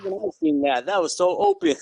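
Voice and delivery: joking high-pitched complaint to the ref; mock yelling. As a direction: climb high in playful outrage without real shouting. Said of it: "I start yelling at the ref too".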